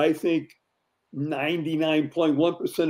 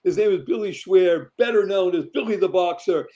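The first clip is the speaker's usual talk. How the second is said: show host introduction; 3 semitones higher, 4 dB louder than usual. Acted. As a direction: herald the guest like a showman. Host delivers guest introduction.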